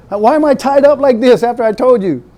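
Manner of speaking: high, loud protest with a laugh; a hammed-up betrayed Samson; indignant Samson. Samson protests being tied up.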